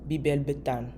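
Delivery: ordinary talk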